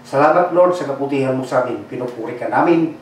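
low, soft and hushed prayer voice. Acted. Pastor praying over the congregation, hushed and low. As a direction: pray softly over the church. Pastor prays before the sermon.

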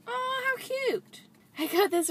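high awed aww tone; gushing. Real, not acted. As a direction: coo at the card. Seeing a cute card.

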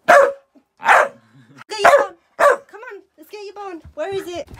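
high-pitched coaxing; excited dog talk. Real, not acted. Owner urging dog to get the bone, high excited coaxing. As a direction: spur a pet on, pitched right up.

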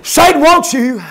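SATAN hurled out loud, falling at the end; shouted preacher's warning; loud proclamation. Acted. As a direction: boom it to the whole church. Preacher repeats line, soft then loud.

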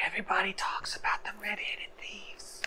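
very soft, furtive whisper. Acted. Jinx gossips secretly about the thieves, whispering. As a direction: whisper so those nearby won't know. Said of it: "Why are you whispering?".

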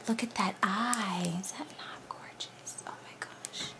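hushed, barely voiced wonder; very soft, breath-only admiration; awed whisper. Real, not acted. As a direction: whisper as if struck by beauty. Awed by a beautiful design.

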